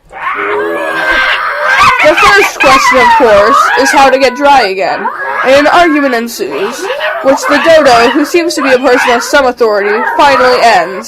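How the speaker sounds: booming storyteller; loud dramatic narration. A narrator's reading. Narrator describes the argument, loud and building. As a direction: proclaim it to the back row.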